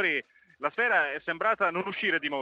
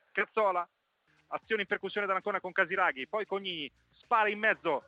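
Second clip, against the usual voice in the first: normal talking voice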